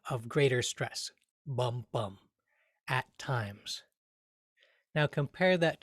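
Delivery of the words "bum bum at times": soft "Bum, bum, at times" with equal beats; two equal soft beats; even beats. Shown as a demonstration. Teacher showing trochee rhythm quietly. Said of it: "two beats to it".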